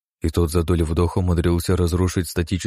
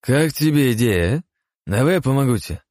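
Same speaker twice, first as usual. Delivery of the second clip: put-on drunk coaxing; 8 semitones higher, 3 dB louder than usual. Roman coaxes drunk Osman.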